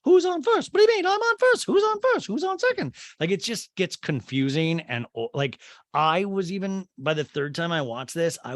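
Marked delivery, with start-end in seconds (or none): 0.0-2.9 very high, loud, rapid-fire confusion; mock comedy bickering. Recapper parodies confusing argument, high comic bickering. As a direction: squawk the routine in a high put-on voice.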